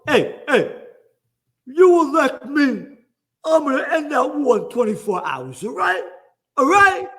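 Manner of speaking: high, loud mock Trump voice. Mocking Trump's campaign promise to end the war. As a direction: ham up a boastful candidate promising. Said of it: "talking about the war with Ukraine".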